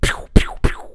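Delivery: quick airy, voiceless pews; bullet sound effects. Retold or imitated. Showing the sound of the thrown ball, bullet noises. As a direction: fire off imitated bullet noises. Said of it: "That type of sound".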